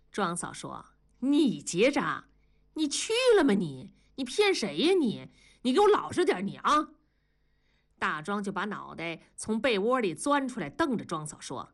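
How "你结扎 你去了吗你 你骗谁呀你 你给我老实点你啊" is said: high, incredulous challenge; indignant scolding. Acted. Wife doubting her husband's excuse, indignant scolding. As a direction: scold him in rising, high-pitched disbelief.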